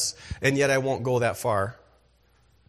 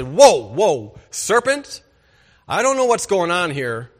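loud, sharp call to halt the serpent; stern protective shout; 2 semitones higher, 6 dB louder than usual. Retold or imitated. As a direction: step in and stop him loudly. Adam should have stopped serpent.

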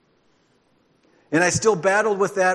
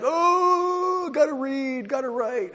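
high, whiny mock complaint; imitating classmates' reluctant moan; mock groaning classmates; 9 semitones higher, slower than usual.

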